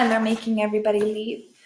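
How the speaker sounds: usual speaking voice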